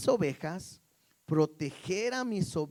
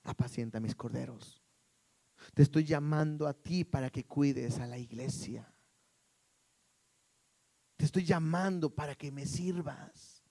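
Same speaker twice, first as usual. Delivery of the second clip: entrust it gently, lowered and calm; gentle commission; 3 semitones lower than usual. Acted. Jesus calling failed Peter to serve, gently. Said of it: "Jesús es completamente diferente y le dice".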